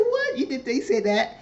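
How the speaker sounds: high squeak of disbelief; shocked. Pretend. Viewer reacting to the confession, shocked. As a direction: jump way up in shock.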